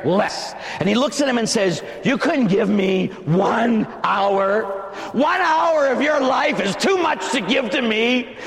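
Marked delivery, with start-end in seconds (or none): 2.0-8.4 high, raised-voice rebuke; pained reproach. Acted. Preacher as Jesus rebuking sleeping apostles, indignant high shout. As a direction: demand it in disbelief, stressing each word.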